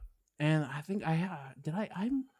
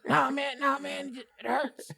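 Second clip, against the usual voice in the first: whiny sick friend; 11 semitones higher, slower than usual. Retold or imitated. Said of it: "talking about".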